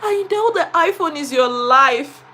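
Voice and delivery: put-on high, drawn-out childlike apology; children apologizing. Children apologize to Miss Blessing, sing-song. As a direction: plead sweetly for forgiveness. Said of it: "they were like".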